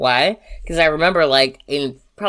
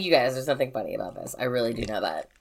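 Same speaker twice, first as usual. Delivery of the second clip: softer, lower mock-earnest warning; serious; 4 semitones lower, 9 dB quieter than usual. Bo telling us nothing is funny about this, imitated serious voice. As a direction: imitate a friend insisting it's no joke, hushed down. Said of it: "Take it seriously".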